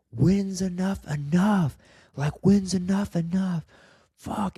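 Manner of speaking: high, strained re-enacted outburst, slowing on the question; retold angry snapping. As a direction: act out losing it at your wife. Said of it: "I just snapped".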